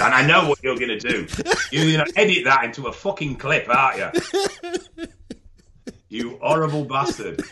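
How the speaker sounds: high, laughing mock accusation. Real, not acted. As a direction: scold him through laughter.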